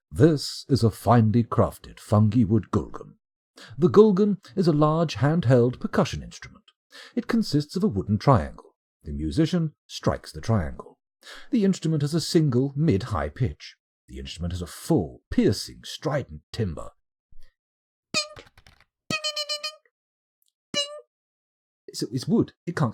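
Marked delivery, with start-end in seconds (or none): 0.1-16.9 hammed-up description voice; mock formal read. Reading out the item description. 18.1-20.8 high sung dings imitating a triangle; ping out the bell sound; mouth-made ding noise; vocal ding.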